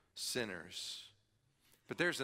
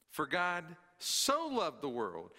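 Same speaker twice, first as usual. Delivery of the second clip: "so" slowed and leaned on, falling after; stress on so; 3 semitones higher, slower than usual. Shown as a demonstration. Preacher quoting John, stress on "so". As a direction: lean hard into the word "so". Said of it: "I put the emphasis on the word "so".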